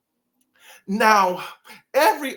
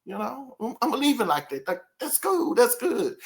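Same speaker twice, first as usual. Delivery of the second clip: complacent Sir Charles; 6 semitones higher than usual. Retold or imitated. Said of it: "I get the feeling like you're probably saying".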